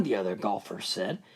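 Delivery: usual speaking voice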